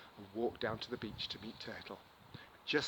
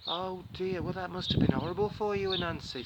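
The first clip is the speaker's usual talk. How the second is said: higher, caring turtle; sympathetic Turtle; 5 semitones higher, 5 dB louder than usual. Acted. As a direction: comfort Anansi kindly. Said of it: "said Turtle".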